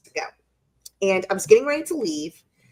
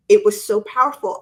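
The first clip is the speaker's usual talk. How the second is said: ordinary talk, nothing marked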